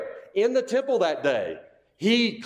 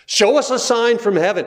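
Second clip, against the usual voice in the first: louder, higher demand; 4 semitones higher, 7 dB louder than usual. Retold or imitated. Preacher voicing the leaders' demand, louder. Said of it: "this is what they're asking for".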